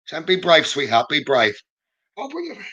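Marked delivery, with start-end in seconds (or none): 2.2-2.6 hushed, high, very soft voice; soft aside. Retold or imitated. Medium relaying a spirit's promise to Karen, hushed and high. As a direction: murmur it high and faint.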